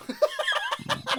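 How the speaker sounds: high squealing giggle. Real, not acted. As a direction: burst into a squeal of laughter. Laughter burst.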